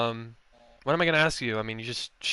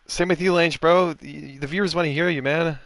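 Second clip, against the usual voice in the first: raised voice urging the driver to share his mic; calling out; 6 semitones higher, 6 dB louder than usual. Real, not acted. Streamer calling out to Lange, loud and urging. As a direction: call out to get him on the mic.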